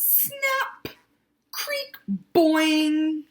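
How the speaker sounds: high, cartoon snap creak boing; squeaky sound effects of a spring trap. Reader voicing the trap, high comic noises.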